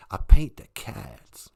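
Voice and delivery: slow, drawn-out lightly voiced Bush impression; George W. Bush voice. A comedian doing George W. Bush, slow and drawn out. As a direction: drag out "I paint the cats" slowly, a mild Texan president. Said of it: "That's what George Bush, George W. Bush sounds like".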